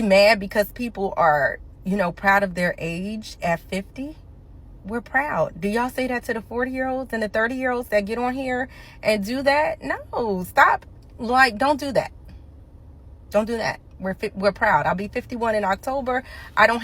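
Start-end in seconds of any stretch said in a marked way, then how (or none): none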